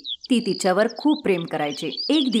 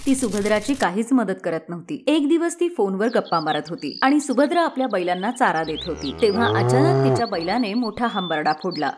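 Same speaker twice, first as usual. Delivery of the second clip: ordinary delivery, nothing marked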